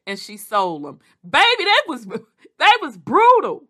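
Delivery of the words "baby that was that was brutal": exclaiming at brutality. Real, not acted. Reacting to wife selling her husband, loud high exclamation. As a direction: sound floored by the story.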